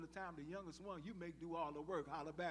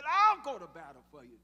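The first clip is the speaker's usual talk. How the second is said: loud declaration; bold vow; 19 dB louder than usual. Retold or imitated. Shepherd boy vowing to fight for the king, loud bold declaration. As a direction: proclaim it like a brave young hero. David volunteering in retold story.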